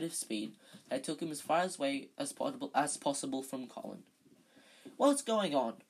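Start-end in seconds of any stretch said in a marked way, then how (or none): 5.0-5.8 frowning. Acted. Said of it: "said Wood, frowning".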